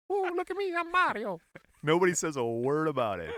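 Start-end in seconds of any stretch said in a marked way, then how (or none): 0.1-1.4 high, cartoonish Italian Mario accent; mock Mario voice. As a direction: ham up a cartoon Italian plumber. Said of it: "Italian racism".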